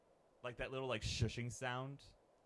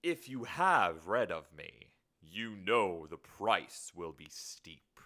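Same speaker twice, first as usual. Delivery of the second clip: slow, drawn-out demon pronouncement; demon naming terms; 3 semitones lower, 5 dB louder, much slower than usual. Acted. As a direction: lean hard on the key words, slowly. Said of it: "This will be the demon".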